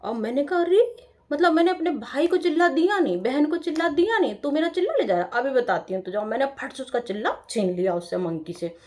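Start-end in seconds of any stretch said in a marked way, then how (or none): 0.6-0.9 high, loud startled "अरे!"; startled cry. Retold or imitated. Girl startled when the monkey snatches her food, high and loud. 1.3-6.2 indignant scolding. Retold or imitated. Scolding the monkey.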